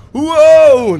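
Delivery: very high, loud shout of joy; ecstatic wow. Real, not acted. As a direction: cry out in overwhelming wonder. Preacher's ecstatic outburst.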